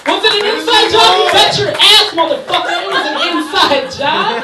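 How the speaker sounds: loud, high, manic rant; shouting. Real, not acted. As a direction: bellow it at the crowd. Hyped conspiracy shouting.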